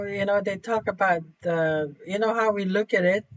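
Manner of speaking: normal talking voice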